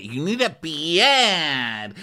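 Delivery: stretched-out, high mock insistence; hammed-up accented voice, very slow; exaggerated impression. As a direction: draw the last word right out. Imitating the excitable Gina.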